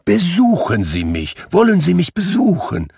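pressing plea that climbs high; eager invitation. Acted. Scrooge inviting the gentleman to visit, eagerly.

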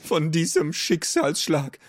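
high, fussy creature voice, hurt; little know-it-all creature. Acted. Klugscheißerchen lamenting its blow, high and hurt.

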